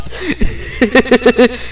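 a soft high giggle, falling; soft laugh. Real, not acted. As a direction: laugh gently at the joke. Laughing after sibling teasing.

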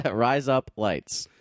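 slow, drawn-out Australian "Rise Up Lights"; slow Australian accent. Shown as a demonstration. Repeating the Aussie razor blades trick, slow Australian accent. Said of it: "your Australian accent to say the word razor".

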